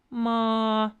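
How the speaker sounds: MA held high and level; first tone. Shown as a demonstration. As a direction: say Ma on one steady high note. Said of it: "That's first tone".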